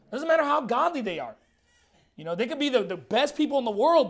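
ordinary talk, nothing marked

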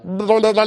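rapid tongue-rolling gibberish babble; gurgled mock-language noise; imitated bubbling gurgle. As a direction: mimic an unfamiliar language as bubbling sounds. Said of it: "bubbling, gurgling sounds".

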